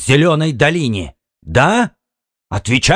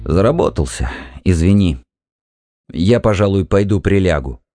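subdued, tired husband; weary; 2 semitones lower than usual. A narrator's reading. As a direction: excuse yourself wearily to go and lie down. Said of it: "Он устало через силу улыбнулся". (устало, извиняясь)

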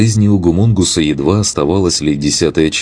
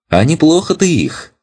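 bright, much higher sudden exclamation; excited; 13 semitones higher than usual. Acted. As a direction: pop up cheerfully right by his ear. Sudden voice over ear.

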